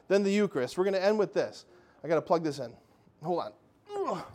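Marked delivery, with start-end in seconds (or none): none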